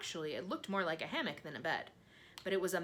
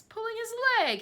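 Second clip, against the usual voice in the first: much higher, louder gleeful cry; hysterical cry; 11 dB louder than usual. A narrator's reading. Centipede cries out in glee at the earthworm. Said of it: "he cried".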